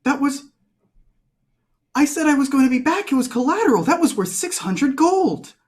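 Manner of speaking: shrill indignant rant. Acted. Bea's outraged argument.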